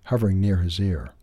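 ordinary delivery, nothing marked

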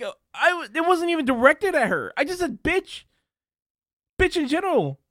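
high-pitched, flustered mock protest; baffled. Streamer defending himself to angry viewers, high baffled protest. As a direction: imitate someone pleading total innocence. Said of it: "he was so baffled. He was like".

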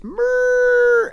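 very high, very loud creature scream; Grimace. Acted. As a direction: scream a wordless monster cry to burst eardrums. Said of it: "he screams so loud it bursts everyone's eardrums".

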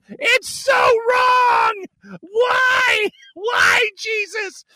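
very high, drawn-out wail; hammed-up cry of agony through hysterics; mock maniacal wailing. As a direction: wail it like you're being tortured and tickled. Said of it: "laugh maniacally like a crazy person".

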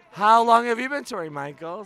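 hollered challenge; calling out a question. Real, not acted. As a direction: shout the question across the room. Calls out question to another person.

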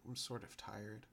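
soft sleepy murmur. Acted. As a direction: murmur it drowsily, almost asleep. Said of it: "before murmuring".

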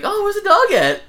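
high, loud, hopeful question; imitated excited inquiry, way up in pitch; eager roommate. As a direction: chirp it like arriving home expecting a puppy. Voicing roommate arriving home, expectant.

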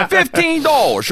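high, slow disbelief at the price; hammed-up disbelief over fifteen dollars; incredulous. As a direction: stretch it out in mock shock.

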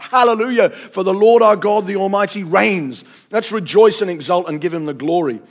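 loud, exultant multitude proclamation; crying out. A narrator's reading. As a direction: declaim praise high and strong, like thunder. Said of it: "the voice of a great multitude".

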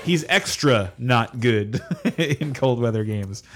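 laughing through a slow punchline; chuckles while teasing, drawn out slowly. Real, not acted. Host laughing while mocking the quarterback's cold-weather games.

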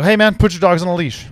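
called out loud across the road; retold yell. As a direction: shout it at a stranger down the road. Yelling at dog owner.

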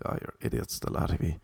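breathy, under the breath; scornful aside, barely voiced; muttered insult. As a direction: say it to yourself in disgust. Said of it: "You're just like".

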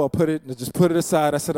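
ordinary talk, nothing marked

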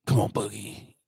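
conspiratorial whisper; hushed, unvoiced aside to Boogie. Real, not acted. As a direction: lean in and whisper it like a secret. Teasing friend Boogie.

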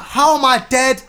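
outraged demanding questions, laughing through them; retold angry disbelief. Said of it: "I was like".

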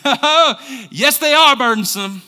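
loud, high mock objection; protesting congregant. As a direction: object loudly as a fed-up churchgoer. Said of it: "some of you are going".

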